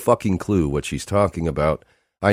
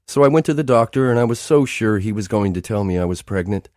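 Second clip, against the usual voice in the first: fast, higher, tumbling words; rushed; 7 semitones higher than usual. Acted. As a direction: blurt it all out in a hurry. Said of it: "talks quickly, rushed".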